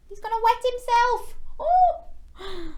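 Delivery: mock shock. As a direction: pretend gasp for the kids.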